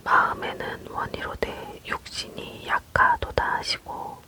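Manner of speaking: usual speaking voice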